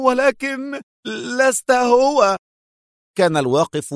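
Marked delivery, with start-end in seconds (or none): 0.1-2.4 much higher, panicked; terrified cry against the wall. A narrator's reading. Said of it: "يقول مذعورا".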